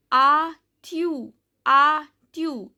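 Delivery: slow "a tu", ending on the vowel; "a tu" in teacher's careful French; silent final S. Shown as a demonstration. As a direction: say "a tu" carefully with no S sound. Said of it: "S is silent".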